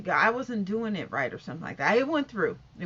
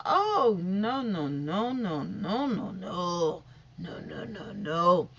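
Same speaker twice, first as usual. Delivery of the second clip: dismayed no; 4 semitones lower, slower than usual. Retold or imitated. Watching glove cross-contamination.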